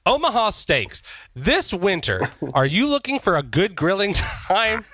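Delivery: playful fake advert delivery; mock ad read. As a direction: pitch it like a commercial announcer.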